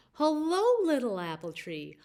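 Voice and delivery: bright, high bird voice greeting the tree; a cheerful bird calling hello; Wise Bird's voice. A narrator's reading. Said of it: "Wise Bird came by and said".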